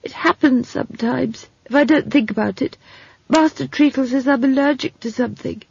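high, resigned explanation; stammering young man, resigned. Acted. Simon explaining his trouble to Esk, resigned. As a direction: explain the affliction without much hope.

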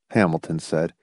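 ordinary delivery

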